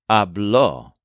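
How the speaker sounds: "habló" said ab-LO, stress on the final ló; "habló" with the weight on the last syllable, ló; stress on second syllable. Shown as a demonstration. Teacher modelling "habló" for learners, stress on ló. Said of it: "also with a stress on the second syllable".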